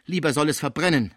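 loud, high-pitched outburst; exclaiming. A narrator's reading. Schmucke on hearing what happened to Pons, crying out. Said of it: "rief er".

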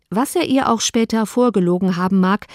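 usual speaking voice

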